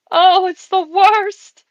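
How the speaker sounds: high, loud, drawn-out commiseration; exaggerated pet sympathy. Real, not acted. Streamer pities pet over cilantro smell, exaggerated high wail.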